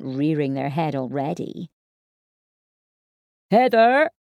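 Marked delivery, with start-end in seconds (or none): 3.5-4.1 loud call from the door. Acted. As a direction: shout her name from afar. Boyfriend calling from door.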